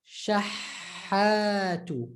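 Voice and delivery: Shahatu, very slow, the ha held a bit; held doubled ha. Shown as a demonstration. Teacher showing the held ha without throat pressure. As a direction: hold the doubled ha in Shahatu gently. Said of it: "It's a bit stressed".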